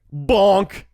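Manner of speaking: high, loud cartoon bonk; bonk sound. Narrating the can smacking his forehead, loud cartoon bonk. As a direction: punch it like a cartoon hit.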